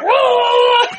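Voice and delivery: high, loud laughing yelp; mock startled yell. Retelling her fright at her husband growling in bed, yelping. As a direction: shriek it like she just jolted awake.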